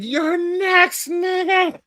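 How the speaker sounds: high screeched imitation of the yelling man; mock scream. Retelling what the man in Minneapolis screamed, high screech. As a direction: shriek it like the man in the clip. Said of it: "literally screaming".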